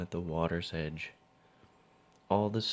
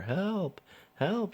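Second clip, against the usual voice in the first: high panicked shrieks for help; frightened lady shrieking for help; 13 semitones higher than usual. A narrator's reading. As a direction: shriek it out in terror. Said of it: "she shrieked".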